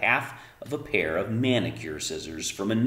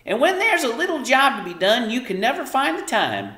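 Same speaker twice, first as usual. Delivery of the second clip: high, loud nagging mother; Homily nagging; 13 semitones higher, 6 dB louder than usual. A narrator's reading. Mother lecturing daughter about chores, high loud nag. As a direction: scold her, voice pitched up. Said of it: "Homily would say".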